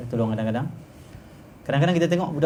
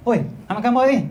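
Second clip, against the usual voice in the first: high-pitched cross demand; acted scolding; 10 semitones higher, 3 dB louder than usual. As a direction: play a friend picking a quarrel. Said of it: "marah-marah". (lakonan menegur dengan marah)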